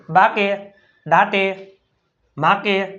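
બાકે, દાટે, માકે with long 'aa' and voiced final 'e'; mock misreading. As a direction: imitate learners misreading in two syllables: બાકે, દાટે, માકે. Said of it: "શું વાંચે?". (બાકે, દાટે, માકે એવું ખોટું વાંચન)

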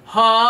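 loud held ha at comfortable pitch; low sustained ha. Shown as a demonstration. Coach showing a comfortable low ha. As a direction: hold a firm ha. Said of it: "more comfortable low pitch".

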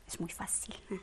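a voiceless, confiding aside; whispered aside. Real, not acted. Teacher whispers reassurance to the student, whispering. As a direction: whisper that it is easy.

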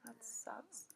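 disappointed whisper under breath. Real, not acted. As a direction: breathe it out, let down.

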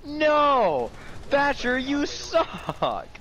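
high, drawn-out wail of protest; mock outrage. Real, not acted. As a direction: cry out high as if betrayed. Yelling at Thatcher in game.

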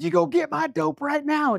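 high-pitched mock yell at the boyfriend; retold yell. Agitated woman yelling at her boyfriend, high mock yell. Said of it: "she's yelling at her boyfriend".

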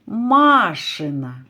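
машина with the first А long and strong; stress on first syllable. Shown as a demonstration. Teacher asks whose car, Маша's: машина with long first А. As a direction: draw out the opening syllable of машина. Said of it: "Пошукаємо наголос у слові".

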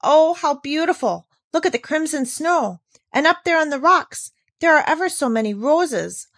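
high delighted cries sinking to loss; marvel, then mourn the fading colour; wonder to dismay. Acted. Girl watching the glow fade, wonder turning to dismay.

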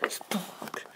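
creepy whispered name; eerie whispered call. Acted. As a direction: hiss it from the dark. Villain whispers his name.

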